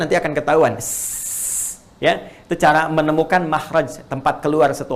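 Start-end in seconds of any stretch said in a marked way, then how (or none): 0.8-1.8 a bare hissed s with no vowel; teacher demonstrating sukun s; voiceless s. Shown as a demonstration. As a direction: hiss the S alone.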